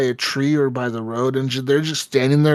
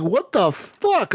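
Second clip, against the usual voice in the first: drawn-out, higher disbelief; slow baffled what the; 6 semitones higher, much slower than usual. Retold or imitated. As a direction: stretch it out, stunned at being punched. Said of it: "I'm like".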